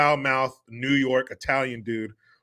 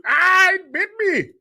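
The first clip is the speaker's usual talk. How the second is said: high yelp, hammed-up Indian dude bitten by a snake; imitating Saul Rosenberg's yelp; mock pained Indian accent; 7 dB louder than usual. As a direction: squeal it like the snake just bit you. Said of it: "Saul Rosenberg, the Indian dude".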